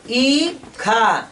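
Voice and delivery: ईखा with the ई held very long and slow; teacher slowly drawing out ई in ईखा for the class; drawn-out long vowel. Shown as a demonstration. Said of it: "यहाँ देरी से बोलेंगे".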